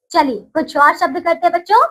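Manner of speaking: ordinary talk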